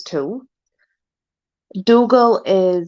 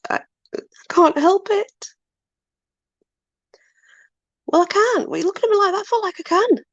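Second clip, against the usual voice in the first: high sing-song voice for the dogs; baby talk to dogs; 12 semitones higher than usual. Acted. High cooing at her dogs. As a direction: chirp up high at the pets.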